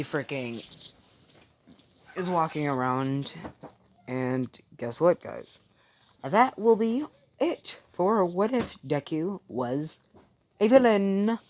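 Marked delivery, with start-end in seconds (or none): 6.2-11.4 higher, slower announcer-like outro; slow, pitched-up closing flourish; sign-off announcement. Real, not acted. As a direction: announce the title in a sing-out, stretched delivery. Video sign-off announcing the title.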